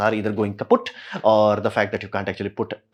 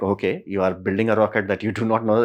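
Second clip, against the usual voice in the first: ordinary talk, nothing marked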